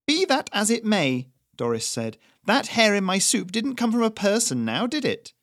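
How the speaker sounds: put-on high, loud, smug old woman; snide accusation. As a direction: needle him with mock politeness. Doris accuses the chef.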